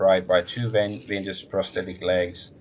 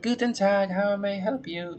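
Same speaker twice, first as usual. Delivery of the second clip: lilting, higher sing-song phone greeting; 13 semitones higher than usual. Acted. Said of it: "in a sing-song tone".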